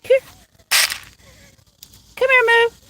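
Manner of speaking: high coaxing cat call. Real, not acted. Owner calling her cat Moo, high coaxing call. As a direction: coax the cat over, sing-song and high.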